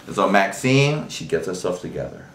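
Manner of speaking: normal talking voice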